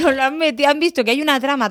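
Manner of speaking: laughing. Real, not acted. Host laughs about youth learning Roman history, laughing.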